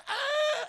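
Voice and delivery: high, loud shriek of fright. Retold or imitated. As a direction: scream like the mother in the film. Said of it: "And she goes".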